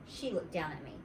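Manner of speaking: ordinary delivery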